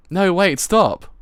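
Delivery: high, loud call to halt; acted alarmed shout; urgent protest. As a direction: cry out to stop them.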